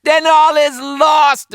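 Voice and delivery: high, loud wail of despair; panicked pirate. A narrator's reading. Frightened pirate wailing to his mates, high-pitched. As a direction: cry out that all is lost.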